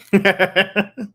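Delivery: loud high laugh. Real, not acted. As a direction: crack up at your punchline. Laughing at own punchline.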